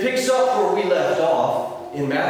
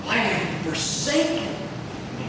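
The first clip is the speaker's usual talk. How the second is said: "forsaken" lifted and stretched, "me" left trailing; stress on forsaken; 6 dB quieter than usual. Shown as a demonstration. Preacher trying another emphasis on the verse, stress on "forsaken". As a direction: put the weight on "forsaken". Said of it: "put different emphasis on sentences".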